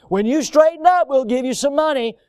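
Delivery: mock stern parent to a rebellious child. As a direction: lay down terms like a strict parent. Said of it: "talking to a rebellious child".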